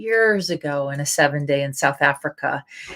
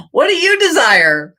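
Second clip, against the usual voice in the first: high, loud mock demand; hammed-up eager question; playful asking; 10 semitones higher, 6 dB louder than usual. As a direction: call it out playfully, pitched way up. Playfully addressing the body after laughing.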